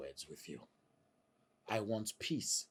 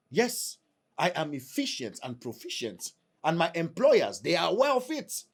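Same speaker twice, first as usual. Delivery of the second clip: boastful; 9 semitones higher, 7 dB louder than usual. Acted. Husband boasting to wife.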